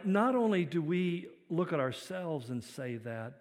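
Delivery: normal talking voice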